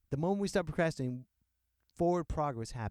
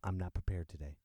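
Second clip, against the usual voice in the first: low quiet admission; 8 semitones lower, 7 dB quieter, faster than usual. Real, not acted. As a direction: lower and softer, "I'm not prepared today" mumbled down at the end. Quiet confession, then repeated loudly.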